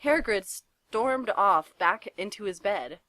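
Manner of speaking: usual speaking voice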